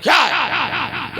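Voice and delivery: high, drawn-out exasperated retort; imitated irritation. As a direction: snap out of sleep with a long, annoyed whine. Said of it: "अब इसका तेवर बदल गया". (झुंझलाहट भरा लंबा, ऊँचा जवाब)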